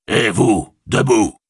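appel aboyé, plus haut; cri d'orque. Acted. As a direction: lance l'ordre comme un garde-chiourme. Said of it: "et hurla". (cri sec d'un meneur d'esclaves)

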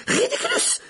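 very high-pitched spell cry; cried-out spell. A narrator's reading. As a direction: shriek out the incantation. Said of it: "s'écria Simus". (incantation criée très aigu)

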